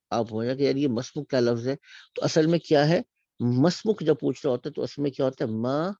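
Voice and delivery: ordinary delivery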